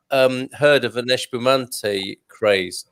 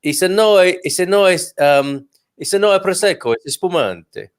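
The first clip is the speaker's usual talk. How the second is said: imitated Italian waiter, pitched up then dropping at the end; Italian waiter accent; 5 semitones higher than usual. Waiter correcting a family on their wine, Italian accent. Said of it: "I think an Italian waiter said".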